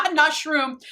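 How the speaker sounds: "Shroom" said as the wrong shade name; laughing at the slip, "Shroom". Shown as a demonstration. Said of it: "y'all know I say things wrong".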